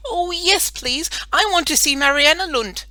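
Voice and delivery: put-on a small child pleading excitedly; eager little girl.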